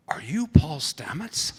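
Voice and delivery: slow, timid whisper; whispered child question. Retold or imitated. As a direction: whisper it like a shy little girl. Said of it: "and she goes".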